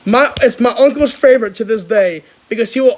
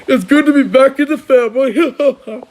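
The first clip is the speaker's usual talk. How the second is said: villain line; 4 semitones higher than usual. Retold or imitated. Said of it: "he's like".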